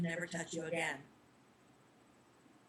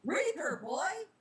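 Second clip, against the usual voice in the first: angry outburst; 9 semitones higher, slower than usual. Acted. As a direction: bark his title at the boy. Reaper loses temper.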